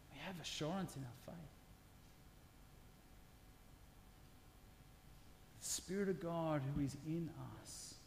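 hushed, reassuring sermon voice; quiet sermon. Acted. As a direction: speak it gently as a promise.

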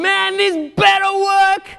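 high, loud, slow cry of dread before battle; desperate shout. Retold or imitated. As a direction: yell it like your life hangs on it. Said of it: "I'd be thinking".

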